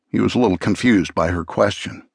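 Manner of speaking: usual speaking voice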